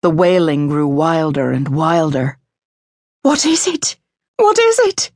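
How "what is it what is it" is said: very high, alarmed gasp; frightened gasp. A narrator's reading. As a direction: gasp it in mounting panic, climbing higher. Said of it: "Missus Lennox gasped".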